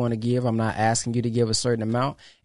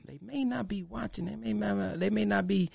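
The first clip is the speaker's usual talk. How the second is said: hushed confiding; 6 semitones higher, 8 dB quieter than usual. Real, not acted. Confiding aside about watchers.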